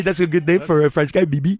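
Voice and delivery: light mock French accent. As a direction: croon it like a French charmer. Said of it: "stop doing the accents".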